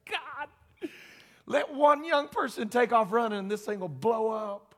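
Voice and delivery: a hint of a weary preacher begging God; lightly voiced tired plea for revival; pleading prayer. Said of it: "And I was like".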